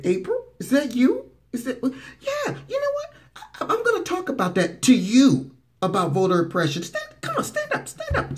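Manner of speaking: mock kindly president. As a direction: coax her up in a silly high voice. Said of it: "And he said".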